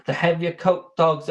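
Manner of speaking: usual speaking voice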